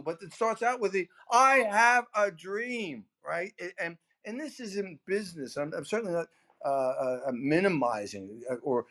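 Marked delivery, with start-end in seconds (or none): 1.3-3.0 slow, drawn-out oratory cadence, higher; Martin Luther King. Retold or imitated. Speaker quoting a great speech to salesmen, slow orator cadence. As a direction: intone it like a famous orator. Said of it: "he spoke in a cadence".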